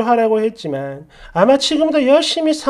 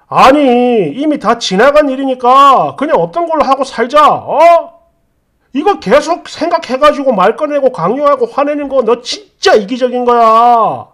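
loud, pleading anger turning to blame; acted fed-up scolding; exasperated husband; 4 dB louder than usual. Acted husband berating wife.

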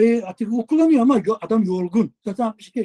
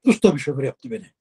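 ordinary delivery

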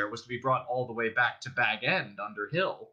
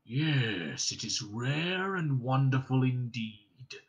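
slow, self-satisfied dragon purr; pleased dragon; much slower than usual. Acted. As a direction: preen over the compliment. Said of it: "absurdly pleased".

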